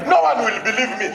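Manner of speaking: ordinary talk, nothing marked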